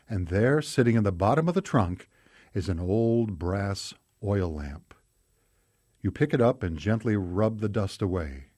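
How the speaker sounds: ordinary talk, nothing marked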